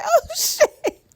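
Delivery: high, laughing oh shit; laughing surprise. Retold or imitated. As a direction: burst out laughing in disbelief. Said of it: "I said".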